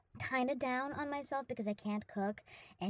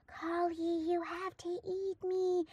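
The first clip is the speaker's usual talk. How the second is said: tempting bacon voice; 6 semitones higher, slower than usual. Retold or imitated. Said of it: "it was calling out, like".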